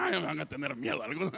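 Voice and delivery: said through real laughter; laughing. Real, not acted. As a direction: joke it out while cracking up. Jokes with congregation, laughing. (entre risas)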